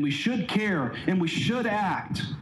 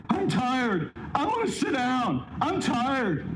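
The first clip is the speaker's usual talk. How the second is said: put-on higher, whiny tired child; whiny kid; 8 semitones higher, slower than usual. Whine it like a worn-out child. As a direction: kid on a long hike whining to a parent, higher and whiny. Kids whining on a long hike.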